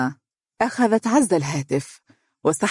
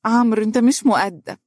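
a high, louder flustered exclaim; 6 semitones higher, 3 dB louder than usual. A narrator's reading. Shy wife ending the call, exclaiming louder. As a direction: call it out embarrassed. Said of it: "هاتفة".

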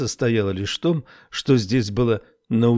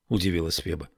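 usual speaking voice

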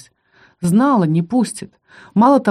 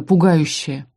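ordinary delivery

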